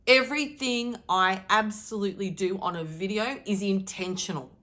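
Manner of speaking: ordinary delivery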